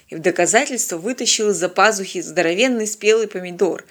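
plain reading voice

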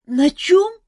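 high, loud startled cry; startled girl. A narrator's reading. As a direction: jolt awake in alarm. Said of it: "переспросила Ольга".

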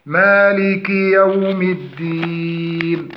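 slow recitation of مالك يوم الدين, long final vowel; model recitation. Shown as a demonstration. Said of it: "شرحنا كما سبق". (ترتيل تعليمي بطيء)

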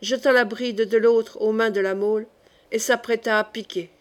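ordinary delivery, nothing marked

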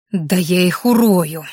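slow, drawn-out threat; mildly acted angry hiss. A narrator's reading. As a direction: hiss it slowly, fuming. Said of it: "зашипела она".

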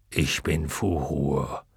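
low, slow, soft dragon answer. A narrator's reading. Luck dragon giving his name to the waters, low and slow. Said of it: "Ich bin Atreo".

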